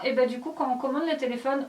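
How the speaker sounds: ordinary talk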